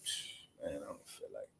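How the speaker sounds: unvoiced, mumbled DMV worker brushing you off; bored clerk. Fed-up clerk grumbling to customers, unvoiced and low. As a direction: sigh it out like you hate your job. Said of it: "everybody else in that motherfucker".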